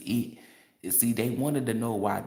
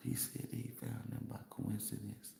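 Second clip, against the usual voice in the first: whisper; 13 dB quieter than usual. Real, not acted. Sly aside about Paul's claim.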